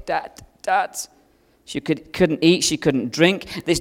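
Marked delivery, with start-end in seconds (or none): 0.0-1.0 softly imitating a sick toddler's croak; a faint, higher, barely-there little croak; weak croak. Father retelling his sick daughter calling him, mock croak. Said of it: "she couldn't barely croak".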